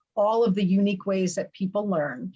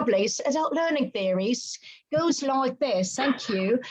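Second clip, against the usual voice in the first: horrible British accent; 4 semitones higher than usual. Shown as a demonstration. Guest making theory sound official, fake British accent. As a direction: put on a bad British accent. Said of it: "I will speak with my horrible British accent".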